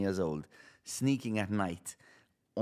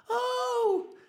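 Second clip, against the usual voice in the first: high, gushing, put-on delight; fake surprise; 11 semitones higher, slower than usual. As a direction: act thrilled at a known gift. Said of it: "then you just act the".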